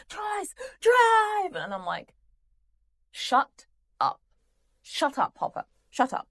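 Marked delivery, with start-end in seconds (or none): 0.1-1.5 high, loud imitation of a frantic order; mock shout, pitched right up; yelling. Hopper yelling at Joyce to get the car moving, mock shout. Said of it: "he's yelling at her". 3.1-6.3 higher, emphatic exasperation; exasperated telling-off. Acted. Viewer sticking up for Joyce against Hopper, exasperated.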